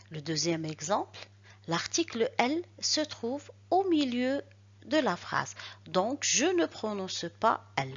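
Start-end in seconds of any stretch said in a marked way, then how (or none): none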